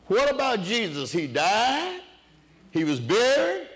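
preaching at full force. Acted. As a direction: drive each line up to the congregation.

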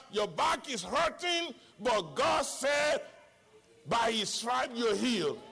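usual speaking voice

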